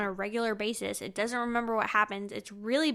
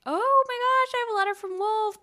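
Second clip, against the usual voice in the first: high and loud gush, rushing; excited squeal; 11 semitones higher, 7 dB louder than usual. Retold or imitated. Davy finding a letter she thinks is from Wolf, excited. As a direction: gush like a thrilled teen.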